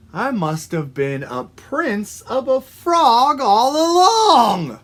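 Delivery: building slowly to a high, loud cry; drawn-out rising exclamation; triumphant realisation. Acted. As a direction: swell to a big joyful discovery. Frog's joyful big realisation.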